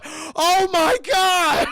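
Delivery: loud, high, drawn-out wail; mock horror at a gruesome find; slow hammed-up cry of shock; horrified cry. Acted horror at finding body.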